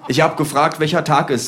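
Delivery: a laughing flatmate repeating himself impatiently; Kolja annoyed. Retold or imitated. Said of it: "sagt Kolja".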